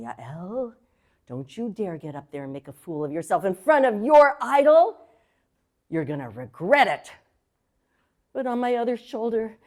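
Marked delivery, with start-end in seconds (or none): 0.0-7.1 low, menacing inner voice warning her off; evil gremlin. Retold or imitated. Evil gremlin on her shoulder scaring her off, low and menacing. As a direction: growl like a little devil on the shoulder. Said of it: "the evil gremlin was saying".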